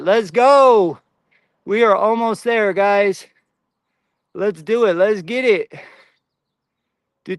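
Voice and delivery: ordinary talk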